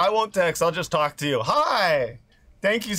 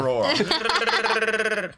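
very slow drawn-out wookiee roar; Chewbacca roar; 3 semitones higher than usual. Pretend. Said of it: "Hear me".

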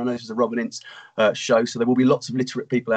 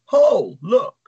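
calling out; 10 semitones higher, 5 dB louder than usual. Acted. Oz calls his friend over.

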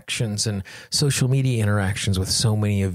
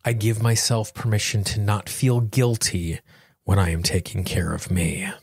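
guided tapping affirmations. Acted. Guiding listeners through a tapping session, slow and calm. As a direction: lead the listener gently through each phrase.

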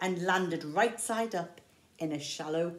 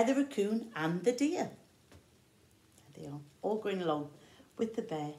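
plain reading voice

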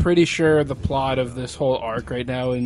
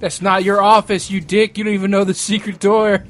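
raised, higher-pitched irate yell; 5 semitones higher, 6 dB louder than usual. Acted. Heckling a game character over the secret door, yelling. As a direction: yell it out in indignation. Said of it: "What if he just yelled that?".

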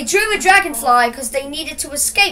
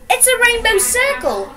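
higher-pitched story exclamation; lightly acted high exclamation; exclaiming; 9 semitones higher than usual. A narrator's reading. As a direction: exclaim it high, at normal volume. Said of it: "exclaimed Joseph".

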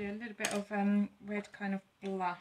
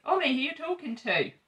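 high, loud call through the window; 7 semitones higher, 10 dB louder than usual. Real, not acted. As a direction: shout it out to the person outside. Calling to Ollie outside.

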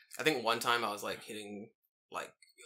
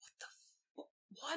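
put-on stunned kid; 12 semitones higher, 18 dB quieter, much faster than usual. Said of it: "The boy was like".

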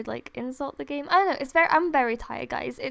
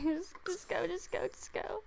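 whispered, much softer, just go just go; hushed urging; 3 semitones higher, 11 dB quieter than usual. Real, not acted. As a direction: whisper it under your breath, willing it on.

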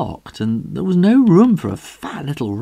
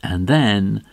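usual speaking voice

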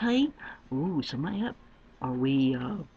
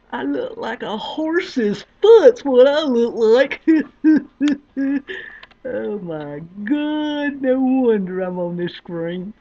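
loud laughing self-mockery; 11 dB louder, slower than usual. Real, not acted. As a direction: laugh loudly at your own face. Laughing at own looks.

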